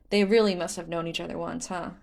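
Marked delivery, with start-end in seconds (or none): none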